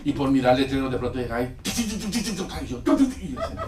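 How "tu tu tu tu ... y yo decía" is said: higher rhythmic tu-tu beeping; imitated warning beeps. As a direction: mimic the alarm, amused. (pitidos imitados agudos)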